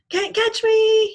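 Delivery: put-on high, loud, slow toddler taunt; hollering taunt. A narrator's reading. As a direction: tease while running off. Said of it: "he hollered".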